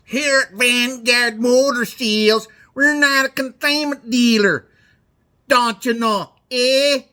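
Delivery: put-on a car-dealer ad in a northern Michigan accent; high Michigan accent. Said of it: "They all have that high northern accent".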